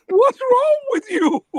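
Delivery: high, laughing imitation of his sister; mock-alarmed sister, high and giggly; sister's alarmed question. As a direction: squeak it out like a startled little sister. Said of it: "She goes".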